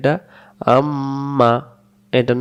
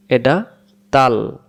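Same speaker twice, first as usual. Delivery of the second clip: ordinary talk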